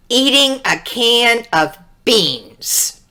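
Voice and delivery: ordinary delivery, nothing marked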